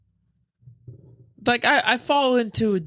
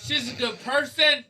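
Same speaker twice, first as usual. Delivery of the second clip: squeaky-high comic defense; pitched-up pleading voice; high-pitched protest; 12 semitones higher than usual. Pretend. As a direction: plead her case way up high.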